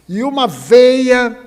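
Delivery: a hint of the amazed doctor; emphatic marvel. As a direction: lean hard into the surprise. Doctor stresses the surprising finding.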